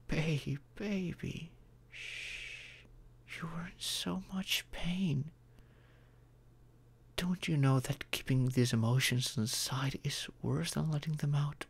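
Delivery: shushing, then soft whispering; whispered comfort; soothing whisper. Acted. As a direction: hush them gently like calming a hurt partner. Soothing crying partner.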